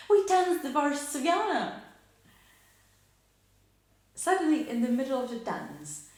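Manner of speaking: high, loud, feverish burst; feverish exclamation. Acted. Widow reliving the dance before the tragedy, high and feverish. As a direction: cry it out, then gradually come down. Climax of tragic dance memory.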